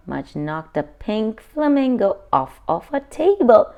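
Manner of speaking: plain reading voice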